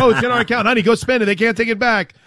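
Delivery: high-pitched, playful conspirator; mock giddy urgency. Bettor telling honey to spend the payout, mock giddy urgency.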